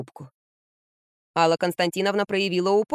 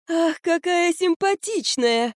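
very high, drawn-out, strained; forced praise; 10 semitones higher, much slower than usual. Acted. Grandmother faking delight at the plain baby. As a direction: squeeze out a fake compliment slowly. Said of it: "выдавила из себя Алла".

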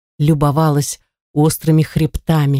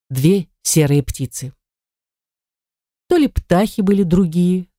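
ordinary delivery

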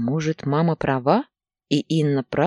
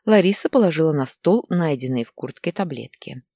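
ordinary delivery

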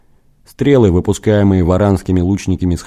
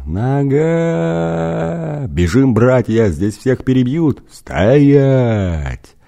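panicked shouting; 6 semitones higher, much slower than usual. A narrator's reading. Burning man and panicking soldiers.

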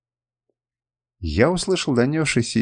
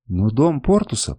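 ordinary delivery, nothing marked

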